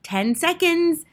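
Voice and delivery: high, slow, loud countdown; drawn-out witch cry. A narrator's reading. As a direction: call it out as a mounting witch. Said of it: "cried the Grand High Witch".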